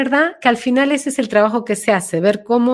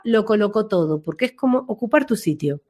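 normal talking voice

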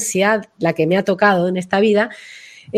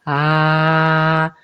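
low chest ah; 5 semitones lower than usual. Shown as a demonstration. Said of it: "ponerse la mano y de sentir".

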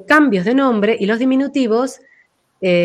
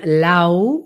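"Lau" low and drawn inside; low, inward "Lau"; voice going inward; 3 semitones lower than usual. Shown as a demonstration. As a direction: sink LAU slowly inward. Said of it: "que va más hacia adentro".

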